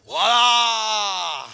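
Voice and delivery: loud triumphant voilà; comic triumphant shout; loud exclamation. Acted. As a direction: land the punchline loudly. Punchline exclamation.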